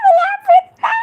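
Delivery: high, loud squeal of excitement; excited shriek. Real, not acted. Host to viewers, shrieking as the guess gets close. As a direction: shriek as if the answer's almost there.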